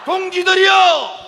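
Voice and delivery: slow, high, shouted slogan; roaring rallying speech. Real, not acted. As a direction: bellow the chant for the crowd to echo. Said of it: "우리의 구호를 함께하겠습니다".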